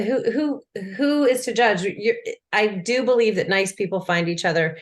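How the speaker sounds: ordinary talk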